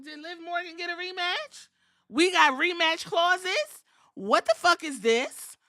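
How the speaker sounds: normal talking voice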